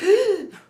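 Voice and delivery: sharp, loud, high gasp; mock scared gasp. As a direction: gasp like a horror-movie audience. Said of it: "everybody's like".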